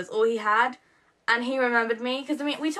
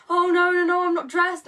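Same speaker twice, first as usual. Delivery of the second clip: higher, louder imitation of an embarrassed guy; flustered protest; 8 semitones higher, 5 dB louder than usual. Imitating Josh caught in tracksuit bottoms, flustered and higher. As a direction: fluster it out, playing him caught underdressed.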